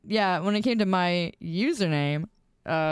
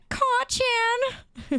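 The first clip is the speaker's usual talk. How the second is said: squeaky mock voice. Mocking anime nickname.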